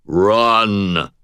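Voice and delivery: a touch of an arcade machine's voice; resonant baritone. A narrator's reading. Arcade game voice warning the wizard to run. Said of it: "said a resonant baritone".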